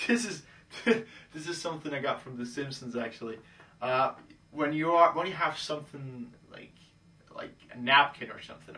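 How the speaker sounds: usual speaking voice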